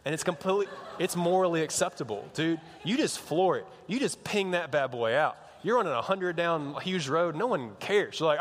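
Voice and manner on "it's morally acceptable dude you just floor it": ordinary talk, nothing marked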